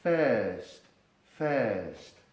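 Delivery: 'thirst' as a long E sound with no R; 'thirst' with the vowel drawn out; silent R. Shown as a demonstration. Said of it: "la I se lee como E y la R se hace muda".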